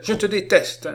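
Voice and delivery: put-on high, loud child's outburst; angry cry. A narrator's reading. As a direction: spit out hatred in a high voice. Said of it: "s'écria-t-elle". (cri de haine, aigu et fort)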